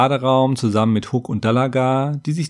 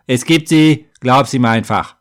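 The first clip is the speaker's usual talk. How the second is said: louder, brusque cutting-in; curt interruption; 6 dB louder than usual. A narrator's reading. Half-gnome silences the doubting scribe, curt. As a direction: shut the doubter up firmly. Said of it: "fuhr Hook mir über den Mund".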